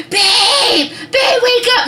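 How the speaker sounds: high-pitched urgent yell to wake someone; loud, high cry to rouse a sleeper. Retold or imitated. Wife waking husband as her water breaks, yelling. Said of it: "I started yelling at my husband".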